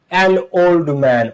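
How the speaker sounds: put-on an old man said very slowly, 'an' linked to the 'o' of old; slow clear demo. Shown as a demonstration. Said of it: "ও তো উচ্চারিত হলে সেখানে অ্যান হবে".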